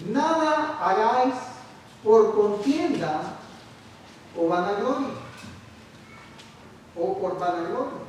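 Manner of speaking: slow, solemn reading of the verse; scripture reading. Acted. Preacher reading Philippians to the congregation, slow and solemn. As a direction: read the apostle's words with weight.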